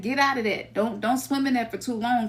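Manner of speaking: ordinary delivery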